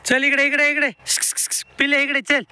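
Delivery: high-pitched call to an animal; calling the calf. Real, not acted. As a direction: sing out to the calf so it comes over.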